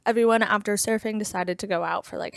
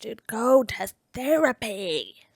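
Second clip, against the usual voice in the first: exasperated; 2 semitones higher, slower than usual. Real, not acted. Exasperated advice to the character.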